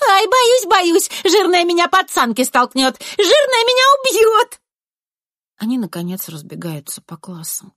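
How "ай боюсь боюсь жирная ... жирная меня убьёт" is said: very high, loud squeals of fake fear; mock-terrified shrieking. A narrator's reading. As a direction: shriek as if scared, to tease. (дразнится, визжит в притворном страхе)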